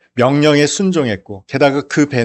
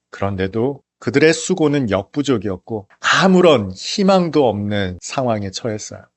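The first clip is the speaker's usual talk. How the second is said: ordinary talk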